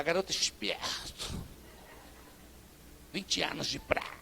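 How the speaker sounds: whispered, slow fake Carioca accent; hammed-up Rio de Janeiro accent, breathy whisper; bad carioca imitation. Pastor mocking outsiders with a fake Carioca accent. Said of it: "eu imitando um carioca".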